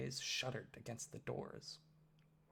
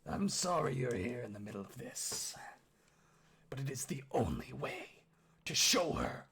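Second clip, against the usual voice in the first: menacing orc father. Acted. Orc father grips Oski's arm, husky gruff menace.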